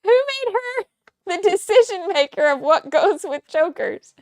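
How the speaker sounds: high, loud mock indignation; mock outrage. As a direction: scoff at the choker rule, high and loud. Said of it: "they're like".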